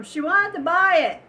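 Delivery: loud, high call across the shop; shouted relay of the order; retold yell. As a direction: imitate her calling out across the room. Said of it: "she yells at the other lady across the room".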